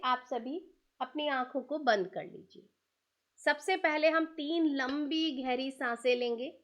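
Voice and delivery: slow, drawn-out breathing instructions; calm breath coach, unhurried; guided breathing cues. Acted. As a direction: stretch each word like a long exhale. Leads slow breathing exercise.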